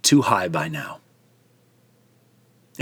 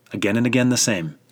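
ordinary delivery

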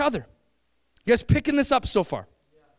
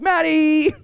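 "Maddie" sung out higher through a laugh; subtler nacho call; 6 semitones higher, 4 dB louder than usual. Shown as a demonstration. Hinting to the wife it's nacho time, laughing. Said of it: "It's more like".

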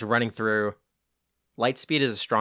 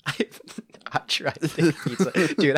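laughing; 4 semitones higher, slower than usual. Real, not acted. Laughing answer.

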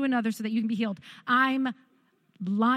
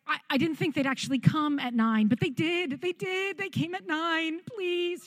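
higher, pleading and panicky; frantic plea; 5 semitones higher than usual. Retold or imitated. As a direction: rush out the plea to the clerk.

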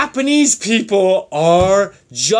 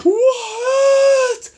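hammed-up, very high scream of shock; shocked shriek. Playing the woman who gets flashed, shocked shriek.

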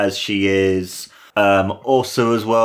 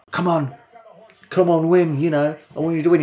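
higher, eager come on, rushing the end fast; urging cheer; 7 semitones higher, faster than usual. Retold or imitated. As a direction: cheer her on like a fan in the crowd. Fans urging her to win.

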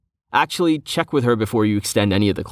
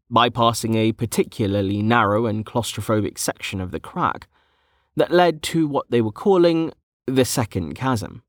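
plain reading voice

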